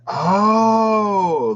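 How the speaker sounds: high, loud drawn-out oh; surprised oh. Real, not acted. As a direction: realise it with delight. Noticing the card.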